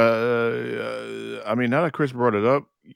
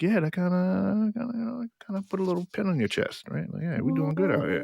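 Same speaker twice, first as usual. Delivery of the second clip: proud self-talk; 10 semitones higher, 3 dB quieter than usual. Acted. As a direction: lightly act out quiet pride, pitched up. Acting out own pleased reaction.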